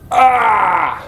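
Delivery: sharp disgusted yell; pained shout. Real, not acted. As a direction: cry out at the gore. Reacting to gore.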